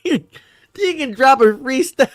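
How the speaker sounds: high-pitched imitation breaking into laughter; laughing mock quote. Streamer repeating the dope beat offer, mocking and laughing. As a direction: mimic the sitcom guy while cracking up. Mocks the sitcom line, cracking up.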